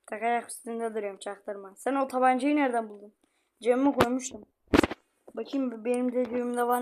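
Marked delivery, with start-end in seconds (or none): none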